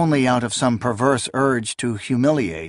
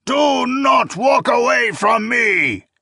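higher, slow shouted howl; howling old priest; 11 semitones higher, 4 dB louder, slower than usual. A narrator's reading. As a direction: howl louder after her. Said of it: "the old priest howled".

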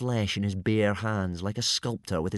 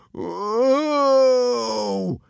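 long, loud, high howl; drawn-out high yowl; howl of pain; 14 semitones higher, 7 dB louder than usual. A narrator's reading. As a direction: howl as if bitten on the bottom. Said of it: "howled Dog's Breath".

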